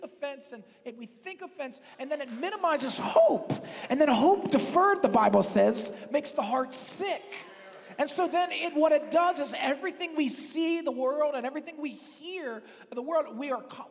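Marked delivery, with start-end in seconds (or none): 2.0-13.9 louder, driving sermon climax; preaching at full force. Acted. Pastor warning the church about offense, preaching at full force. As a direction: pastor pressing the point home to the congregation.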